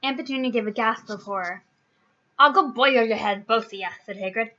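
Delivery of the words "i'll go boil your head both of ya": loud dismissive jab; mildly irate brush-off; angry retort. A narrator's reading. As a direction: snap back roughly, easing off. Giant angrily tells them off.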